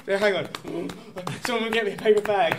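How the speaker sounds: gasping for breath, laughing; panting, out of breath after a long read; hyperventilating. Real, not acted. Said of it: "I'm hyperventilating".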